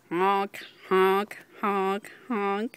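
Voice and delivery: silly goose honk. Shown as a demonstration. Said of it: "What does a goose say?".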